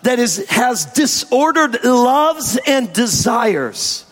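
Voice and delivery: sermon delivery to the congregation; preaching. Acted. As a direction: preach it from the pulpit.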